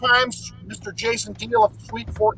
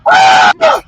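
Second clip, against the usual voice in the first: sharp, very high, loud scream; a storm chaser's excited scream; high imitated scream; 11 dB louder than usual. Storm chaser screaming at the tornado, high loud scream. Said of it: "he's screaming around".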